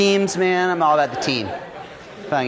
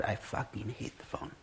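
whispered fierce confession; 5 semitones lower, 18 dB quieter than usual. Real, not acted. Telling listeners about hating the phone, whispered. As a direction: breathe it out, no voice, bitter.

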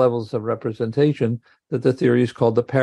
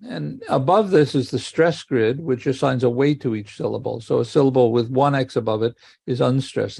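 ordinary delivery

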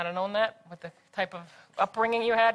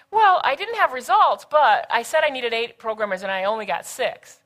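mock a worker pleading their case; imitated excuse-making; 2 semitones higher, 6 dB louder than usual. People coming in without results, mock excuse-making.

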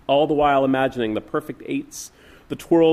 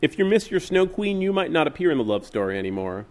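usual speaking voice

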